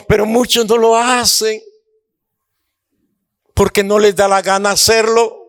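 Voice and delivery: high, driving rebuke from the pulpit; preaching at full force. Acted. Preacher scolding the church for not giving, in a high fervent preach. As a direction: hammer home the people's disobedience.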